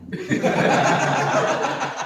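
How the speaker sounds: high breathy burst of laughter; sudden high laugh; big laugh. Real, not acted. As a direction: laugh out loud at the joke. Laughing at his own Dracula joke.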